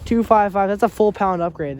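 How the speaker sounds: loud. Real, not acted. Calling out weight upgrade.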